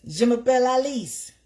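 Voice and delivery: Je m'appelle Alice said high and loud with French flair; French phrase. Shown as a demonstration. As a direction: show off your French class line. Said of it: "that was my name".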